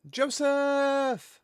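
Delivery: hammed-up cry of a name; cutscene cry. Quoting the game's opening to chat, mock calling out. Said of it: "I just know all the lines from that".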